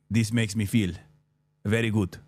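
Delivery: understated Greek accent impression; Greek accent imitation. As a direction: mimic his Greek accent, only half landing it. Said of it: "I'm not doing his accent justice".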